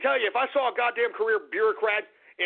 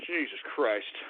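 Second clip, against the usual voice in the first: low, soft exasperation; quiet, worn-out curse; spent muttering; 12 semitones lower, 7 dB quieter than usual. Real, not acted. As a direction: mutter it to yourself, drained. Spent after screaming.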